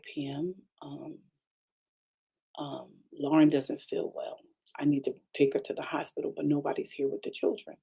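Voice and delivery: low, soft, hesitant retelling of his call; hushed middle-of-the-night phone voice, imitated; subdued husband imitation.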